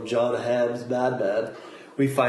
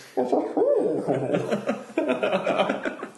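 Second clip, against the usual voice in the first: high burst of laughter; real giggle fit; laughing; 11 semitones higher than usual. Real, not acted. Laughing at own joke.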